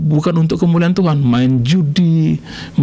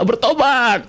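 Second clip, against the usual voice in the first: ordinary talk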